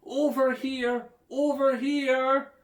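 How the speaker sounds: high, loud, slow calls; shouting. A narrator's reading. Children calling to their parents in the woods, loud and drawn out. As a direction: call out so the parents can find you. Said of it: "As the children shouted".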